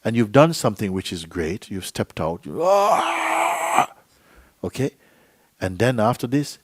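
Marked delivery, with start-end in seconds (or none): 2.6-3.9 long, high-pitched "ah" yell; drawn-out mock holler; imitated shout. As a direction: act out letting a shout go.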